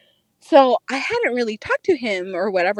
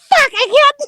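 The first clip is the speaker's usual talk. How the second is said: high, comic frustrated outburst; retold exasperation; 12 semitones higher, 4 dB louder than usual. Struggling with strappy sandals while high, exasperated. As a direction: give up in exaggerated exasperation, pitched way up. Retells failing to put on sandals.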